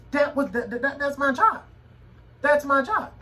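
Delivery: high and loud, pressing the point; that's my job, said high and loud; emphatic insistence. Real, not acted. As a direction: insist it hard, voice climbing. Emphatic insistence on his role.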